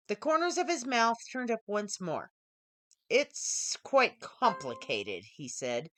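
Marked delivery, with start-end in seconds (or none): 3.1-5.2 lower, slow and knowing; smooth male stranger. Acted. Tall stranger teasing the startled programmer, low and sly. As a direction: let a sly smile sit in it.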